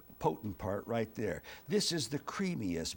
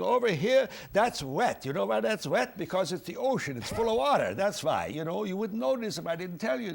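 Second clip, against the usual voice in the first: put-on clowning German accent, pitched up; 5 semitones higher, 3 dB louder than usual. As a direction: play a comic German showing visitors around. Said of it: "I was doing the German accent".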